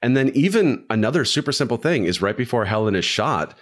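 normal talking voice

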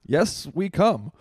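measured politician's slogan, punched out; Obama. Pretend. Co-host joining the president bit, slow loud impression. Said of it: "Obama starts doing fucking".

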